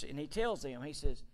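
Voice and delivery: ordinary talk, nothing marked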